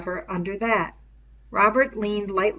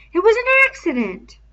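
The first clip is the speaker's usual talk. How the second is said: much higher and louder, shrilling; 9 dB louder than usual. A narrator's reading. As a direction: let the voice climb to a shrill pitch. Piggy repeats his excuse.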